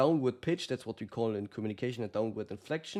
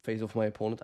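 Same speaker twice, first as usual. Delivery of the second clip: usual speaking voice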